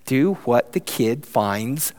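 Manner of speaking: ordinary delivery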